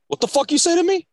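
high-pitched, indignant imitation; mock-serious challenge. Coworker snapping at a colleague, mock-serious. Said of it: "he'll do it so serious".

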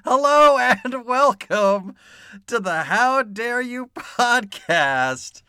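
loud, high, drawn-out welcome; show-opening announcement. Real, not acted. Host welcoming listeners to the podcast, big announcer opening. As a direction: belt out the podcast greeting.

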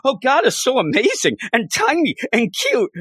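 put-on high, giggling gossip-girl gush; giggly gossipy girls. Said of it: "And they're giggling".

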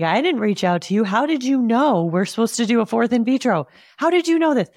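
imagined outburst, high and loud; shocked. Pretend. What she wanted to say to the clinic caller, shocked. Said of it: "sitting there shocked".

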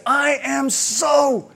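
put-on high, slow stretched child's pout, voice pitched well up; pouty toddler stretching out so angry; angry four-year-old. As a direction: play the furious little girl, dragging it out. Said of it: "she'll just say".

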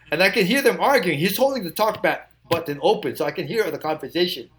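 ordinary talk

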